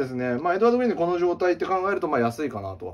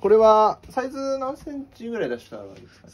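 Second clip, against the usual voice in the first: pushy shop clerk; 8 semitones higher, 5 dB louder than usual. Acted. Pushy clerk pressing customer.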